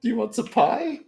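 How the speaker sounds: Grammy voice. Acted. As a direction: play a sweet old granny, high and bright. Goblin still voicing Grammy.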